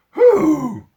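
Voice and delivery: loud high trumpeting hoot; elephant hoot. A narrator's reading. Elephants hoot in fear at the bombing, loud and high. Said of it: "elephant raised their trunks and hooted".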